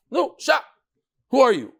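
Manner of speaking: high, indignant hush then challenge; scolding "sha, who are you"; sharp rebuke. Acted. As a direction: put the upstart in his place. Rebuking the interrupting boy.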